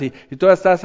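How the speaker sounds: ordinary talk, nothing marked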